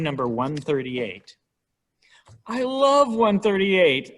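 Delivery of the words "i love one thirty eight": high, loud, drawn-out gush; acted enthusiastic outcry; mock adoring cry. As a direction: cry it out like a devoted fan. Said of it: "I hear you cry".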